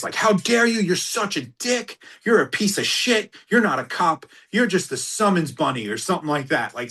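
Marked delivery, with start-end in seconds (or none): 0.2-5.9 high-pitched, indignant retold tirade; pissed-off client. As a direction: imitate an irate client hurling insults. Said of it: "the client is getting pissed".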